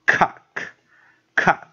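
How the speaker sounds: COCK loud and isolated, with a short open "ah" vowel; pronunciation demo. Shown as a demonstration. Teacher showing the short vowel, pronunciation demo. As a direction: model COCK with short O as "ah", pausing between repeats. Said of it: "补O的短母音念啊".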